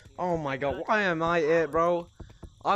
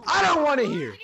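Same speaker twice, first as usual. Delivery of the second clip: raised, higher-pitched protest; fed-up outburst; 5 semitones higher, 4 dB louder than usual. Real, not acted. Fed up after hitting the ceiling fan, loud protest. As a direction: shut them down loudly.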